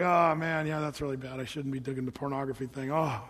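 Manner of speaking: low, subdued mock remorse; imitating a sheepish churchgoer, soft and low; half-hearted contrition. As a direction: sigh through a token admission of guilt. Voices half-hearted guilty churchgoers.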